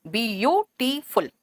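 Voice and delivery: "Beautiful" slowly as bi-yu-ti-ful; broken into syllables. Shown as a demonstration. Teacher letting students feel the syllables, slow and segmented. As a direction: count out each syllable of "beautiful" separately. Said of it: "आपको खुद महसूस होगा कि इसमें तीन".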